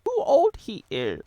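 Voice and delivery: very high, slow creature voice; little alien sage, high and slow; Yoda. Acted. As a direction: draw it out in a high-pitched parody croon. Parody Yoda answers.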